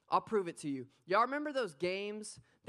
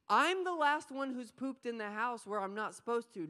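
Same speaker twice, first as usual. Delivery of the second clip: ordinary talk, nothing marked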